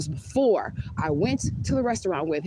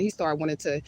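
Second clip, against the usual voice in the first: ordinary delivery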